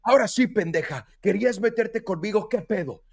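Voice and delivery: playful trash talk at a rival; mock fight taunt. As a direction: square up and taunt the snake. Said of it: "como si me estuviera peleando con una persona".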